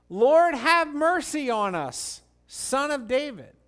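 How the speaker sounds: pleading cry, high and loud. Acted. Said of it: "they cried out all the more".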